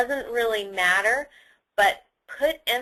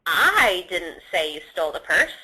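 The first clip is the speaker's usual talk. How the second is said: "I" stretched and stressed, as in someone else said it; 5 semitones lower than usual. Shown as a demonstration. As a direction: lean hard on the first "I". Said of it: "When you say it as".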